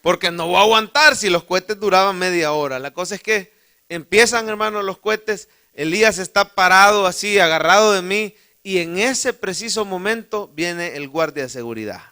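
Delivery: usual speaking voice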